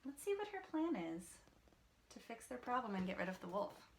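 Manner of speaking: ordinary delivery, nothing marked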